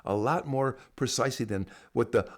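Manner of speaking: ordinary talk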